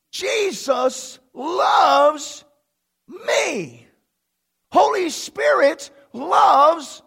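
loud, high, fervent shouted preaching; preaching at full force. Real, not acted. Pastor shouting that God loves him to the congregation, fervent. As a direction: build to a fervent crescendo.

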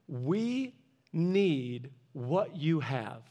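slow, quiet and earnest; sincere plea. Retold or imitated. Pastor tells the old man his church needs him, softly. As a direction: lean in and say it softly.

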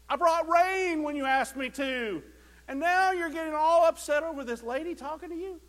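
loud, high mock exasperation; a hint of a fed-up God; exasperated God. Preacher imagining God lecturing Elijah, hammed-up exasperation. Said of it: "I'm sure God is like".

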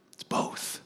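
it's both whispered very softly. Real, not acted. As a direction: drop to a near-silent whisper on "both". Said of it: "the point said twice".

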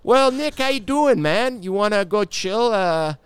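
high, loud, laid-back Canadian buddy; slow invite to the back porch, overdone Canadian; mock Canadian accent. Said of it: "That's not how you talk".

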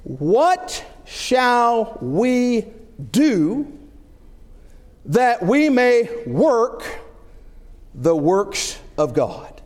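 very slow, spaced-out scripture reading; careful reading. Shown as a demonstration. Preacher reading a question to be heard carefully, slow and deliberate. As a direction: weigh each word, with long pauses. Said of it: "listen to this question very carefully".

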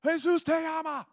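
high, loud street-evangelist holler; imitated yelling. Imitating Chris yelling to people from the car, high holler. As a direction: yell it like out of a car window. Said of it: "and he's yelling".

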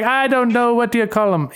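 high, badly faked Jamaican accent; Jamaican gone Irish. Nurse answering the joke, Jamaican accent that sounds Irish. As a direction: try Jamaican and land on Irish. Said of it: "She sounds Irish".

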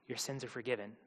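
hushed, falling statement; quiet pronouncement. Acted. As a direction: drop low and pronounce it softly. Jesus gently addresses the paralysed man.

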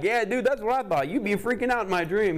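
high, loud, animated voice; excited self-quote. Retold or imitated. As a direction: blurt it out in a high voice, worked up. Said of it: "And I'm like".

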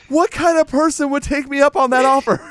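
scandalized disbelief; indignant author. Retold or imitated. Stein outraged that Champ left him, loud and high. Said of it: "And he goes".

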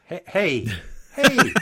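loud, high, hammed-up American "hey"; a bad try at a generic American voice; mock American accent. Greeting his American co-host in a mock American accent. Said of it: "I hope that is not what you think I sound like".

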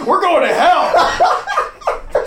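high, slow, loud shout of a bus driver; hammed-up driver yelling the destination; gleeful shout. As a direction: bellow it like a cackling driver off to hell. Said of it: "he's like".

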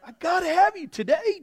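loud, high urgent plea; insistent holler; pleading cry. Acted. Continuing the cried-out plea.

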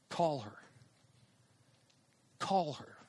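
soft, spaced-out inner prompt; quiet inner nudge. Retold or imitated. A persistent thought nudges the speaker to phone her, softly insistent.

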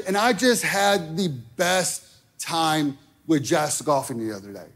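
ordinary talk, nothing marked

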